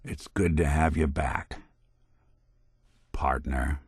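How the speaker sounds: low, softening to a near whisper; warm welcome. Acted. Detective welcoming a partner back, warmly.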